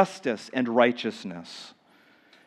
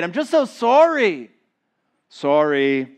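mock remorse; 3 semitones higher, 4 dB louder than usual. Preacher mimics a merely sorry sinner, mocking whine. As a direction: whine it like a caught kid. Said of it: "more than just an emotional state".

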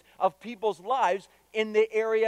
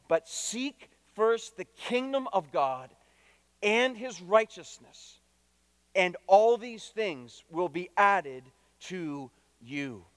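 slow, deliberate scripture reading with pauses between phrases; read slowly; 2 semitones lower, slower than usual. Shown as a demonstration. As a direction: lay out each phrase slowly so it sinks in. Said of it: "I wanna read it slowly".

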